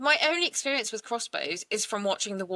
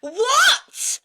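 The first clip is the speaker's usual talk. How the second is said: very high, loud outburst; shocked disbelief; 13 semitones higher, 6 dB louder than usual. Real, not acted. Host reacting to the crossbow law, shocked. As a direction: burst out in utter disbelief.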